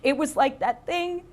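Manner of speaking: high-pitched, horrified interruption; traumatised roommate. Retold or imitated. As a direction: cut in loudly, then recount the horror. Traumatised roommate cuts off apology.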